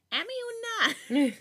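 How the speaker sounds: put-on very high, excited toddler voice; a tiny kid clipping the name; little boy's voice. A narrator's reading. Little brother running to her, high excited toddler call. The way kids do.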